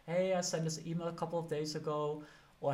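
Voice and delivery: usual speaking voice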